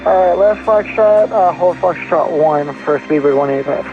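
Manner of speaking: radio headset voice; over a pilot headset. Real, not acted. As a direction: cockpit radio readback.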